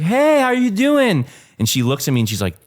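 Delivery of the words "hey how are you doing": high cheery greeting. Retold or imitated.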